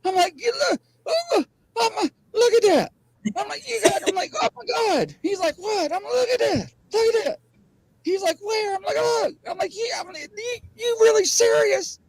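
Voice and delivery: very high frantic back-and-forth; retold flipping out. Storyteller replays pointing out Sasquatch to Gary, frantic high voice. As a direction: act out both sides of a freak-out, squeaking high. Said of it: "I was flipping out".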